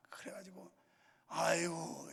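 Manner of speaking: ordinary talk, nothing marked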